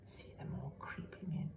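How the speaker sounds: soft whispered storytelling. A narrator's reading. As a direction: whisper so the farmer won't wake. Creeping into house.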